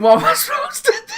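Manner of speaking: high, loud, helpless laughing; retold thought through laughter. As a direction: crack up while asking it, voice shooting up. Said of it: "I'm thinking".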